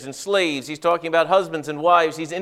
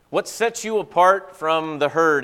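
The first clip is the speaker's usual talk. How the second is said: ordinary talk, nothing marked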